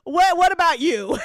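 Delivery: normal talking voice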